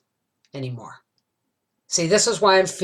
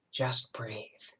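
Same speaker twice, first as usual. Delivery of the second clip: softer still, whispering it away; fading whisper; 7 semitones lower, 13 dB quieter than usual. Retold or imitated. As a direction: let the whisper die out as you calm down. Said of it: "I thought".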